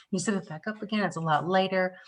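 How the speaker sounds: usual speaking voice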